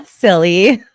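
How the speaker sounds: giggling through the word; laughing. Real, not acted. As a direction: laugh it off as a silly idea. Laughs at therapist's question.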